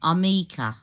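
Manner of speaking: Armica with the stress on the ME; Armica, loud, stress on the me. Shown as a demonstration. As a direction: lean on the middle, arMIca. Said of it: "the emphasis on the me".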